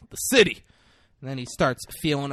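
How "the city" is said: loud, high two-word comeback; mock shouted retort. Denzel answering who pays for floors, mock shouted retort. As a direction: toss it back loudly.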